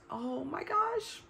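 drawn-out, high oh my gosh; surprised. Real, not acted. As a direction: caught off guard by her own list, stretched slowly. Realizes what she listed next.

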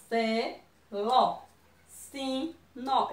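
"Se, lo, sim, nó" in separate beats; split into syllables. Shown as a demonstration. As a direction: pause between each syllable of "Se, lo, sim, nó". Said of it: "Eu abro a boca duas vezes". ("Se, lo, sim, nó" sílaba por sílaba)

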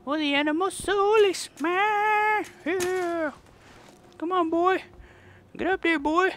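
very high, loud put-on voice; hammy falsetto character. Player voicing a silly animal master, high falsetto. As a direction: cheer the pet on like a cartoon villain.

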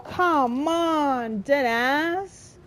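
ordinary delivery

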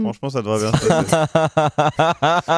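slow, put-on ironic voice; deadpan ironic. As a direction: say it with fake assurance. Conseil ironique. (ironie pince-sans-rire)

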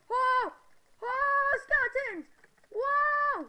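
high, loud panicked shrieks; panicked yelling. Real, not acted. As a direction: shriek it, caught by surprise. Skeletons attack, panicked yelling.

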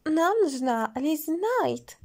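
high, drawn-out declaration; loud exclamation. A narrator's reading. Nora demands Lizi from everyone, drawn-out exclamation. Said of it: "воскликнула Нора".

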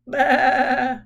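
loud nasal-free baa like a sheep; sheep bleat. Pretend. Sheep outside the window bleats during dinner, a loud baa.

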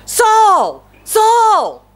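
high, loud divine call; God calling Saul's name, loud and high; voice from heaven. Acted. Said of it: "and the voice said".

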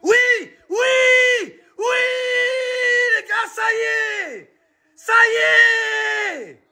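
high, yelled celebration; ecstatic shouting. Real, not acted. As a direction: scream with joy at the goal. Celebrating a goal.

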